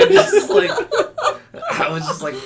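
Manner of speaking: ordinary delivery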